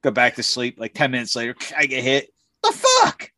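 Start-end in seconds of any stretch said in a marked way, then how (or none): 2.6-3.3 very high indignant mock yelp; startled awake. Snorer hit ten minutes later, high startled yelp. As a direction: squeal it as if rudely woken.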